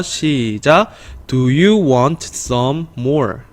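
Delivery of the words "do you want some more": slow, evenly measured read-along; deliberate teacher's read-aloud; slow model reading. Shown as a demonstration. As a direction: lead learners slowly through the sentence. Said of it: "문장 한번 같이 읽어볼게요".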